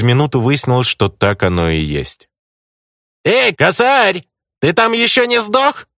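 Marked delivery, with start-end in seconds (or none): 3.2-5.9 high, slow, taunting call; snide mocking voice. A narrator's reading. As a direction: sneer it across the room, much higher. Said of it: "послышался ехидный голос". (ехидный насмешливый голос)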